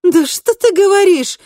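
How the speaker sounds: high, loud, sarcastic comeback; angry retort. A narrator's reading. Kristina disbelieving her double, snapping back angrily. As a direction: snap back indignantly. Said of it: "огрызнулась Кристина".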